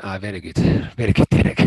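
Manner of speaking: chuckling, not buying it; laughing disbelief. Retold or imitated. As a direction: laugh it off as a prank. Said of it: "I laughed at his face".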